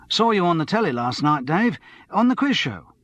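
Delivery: a touch of eager pub-landlord chumminess; lightly voiced chatty barman. Barman greets TV celebrity customer, lightly voiced and chummy.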